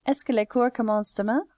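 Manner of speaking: brisk question without breaks; fluent read-back. Shown as a demonstration. Reads back the finished question.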